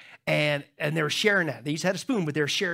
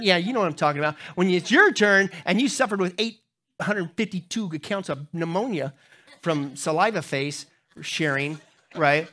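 usual speaking voice

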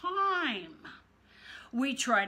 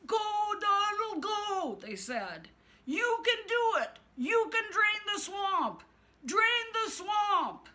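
rally him like fans at a rally; cheering crowd; 11 semitones higher than usual. Acted.

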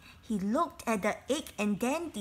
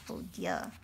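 mock dismay; 6 dB quieter than usual. A narrator's reading. Narrator's dismay at cheating.

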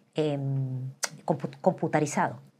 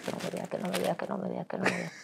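hushed pleading; 2 semitones higher, 6 dB quieter, faster than usual. Retold or imitated. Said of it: "y mi esposo ahí".

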